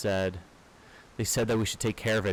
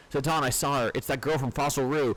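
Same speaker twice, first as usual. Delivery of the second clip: urgent report; 6 semitones higher than usual. Acted. Warning Zidane that Eiko was taken, higher and urgent. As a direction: rush the news out, worried. Alarm after girl is taken.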